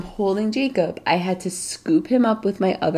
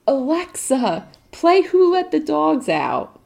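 put-on kid shouting request; 9 semitones higher, 4 dB louder, slower than usual. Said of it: "he'll go".

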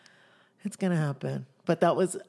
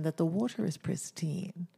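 ordinary delivery

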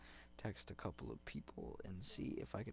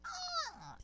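very high mock shriek; clown shriek; 6 dB louder than usual. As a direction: screech like a crazy clown. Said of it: "he looks at you and he goes".

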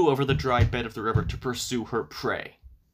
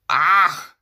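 loud, higher yell of rage; witch's yell; 5 semitones higher, 10 dB louder than usual. A narrator's reading. Baba Yaga finds the cat at the loom, furious yell. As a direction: yell out in fury, louder and higher. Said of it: "yelled Baba Yaga".

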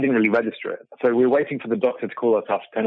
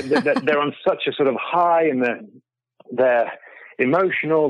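normal talking voice

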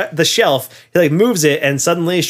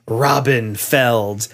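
each word drawn out slowly; slow and deliberate name drop; slow name reveal; 2 semitones lower, much slower than usual. Retold or imitated. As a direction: lay the name down with slow weight. Cage writes his name, room hushed.

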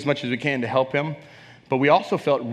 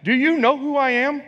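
much higher, puffed-up retort; imagined coach snapping at his assistant; arrogant boss; 11 semitones higher, 3 dB louder than usual. Retold or imitated. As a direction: play the proud star coach brushing off advice. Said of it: "could have said".

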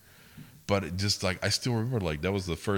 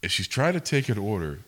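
ordinary delivery

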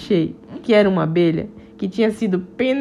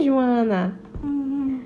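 usual speaking voice

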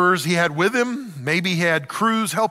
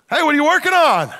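higher, louder, fast nosy neighbor calling over; 10 semitones higher, 6 dB louder, faster than usual. Retold or imitated. Neighbor shouting a question to Noah, higher and louder.

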